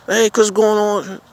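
high greeting, drawn out slowly; a slow, deliberate hey-Chris greeting; slow friendly hello. Pretend. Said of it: "you know my name".

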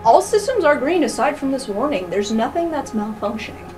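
normal talking voice

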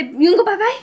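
put-on high sing-song baby talk. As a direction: coo it at the baby.

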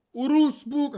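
loud, botched attempt at "Urusbuk"; misspoken name. Real, not acted. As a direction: blurt out "Urusbuk" as a wrong guess at a Turkish name. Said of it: "Wie spricht man diesen Namen aus". ("Urusbuk" laut und falsch geraten)

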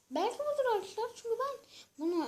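sudden hope that this is it; real excited spike; hopeful excitement. Real, not acted. Hopeful he found it.

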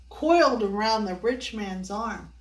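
ordinary delivery, nothing marked

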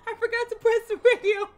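high, loud panicked wail. Real, not acted. Gamer wailing to viewers about the radio. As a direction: cry out the mistake in dismay. Panic repeat after mistake.

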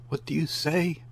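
high, disbelieving demand; stunned question. A narrator's reading. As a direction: ask it again in shock. Stunned mother demands repeatedly.

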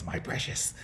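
breathless whispered "my precious", hushed to almost nothing; a quick hushed creature whisper, clutching the fries; Gollum. Retold or imitated. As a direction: hoard it like a creepy cave creature, whispering. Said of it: "I'm like that guy from Lord of the Rings".

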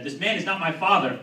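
retold yell. Comic calling to the cops who arrived, retold yell. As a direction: yell it out as a public alarm. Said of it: "I yelled the first thing".

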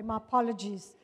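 ordinary delivery

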